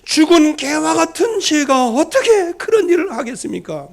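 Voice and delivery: startled protest. Retold or imitated. Hazael shocked by the prophecy, crying out in disbelief. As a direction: recoil in horror at the accusation. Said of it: "하사엘이 깜짝 놀래갖고".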